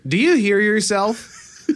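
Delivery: high, slow retold challenge; mock confrontation. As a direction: stretch it out high, as if picking a fight. Retold snap reply to Greg.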